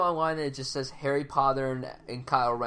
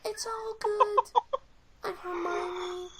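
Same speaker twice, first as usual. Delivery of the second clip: naive heroine. Retold or imitated. Mocking Emma Watson's character, very high ditzy voice. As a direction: play her as a starry-eyed airhead. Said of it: "She's like".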